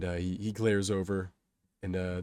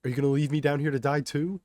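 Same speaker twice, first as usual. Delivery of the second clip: louder, higher, fast indignant question; yelling; 7 semitones higher, 6 dB louder, faster than usual. A narrator's reading. Kato to the group from the tracks, indignant yell. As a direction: fire the question at the crowd. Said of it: "Kato yells at the group".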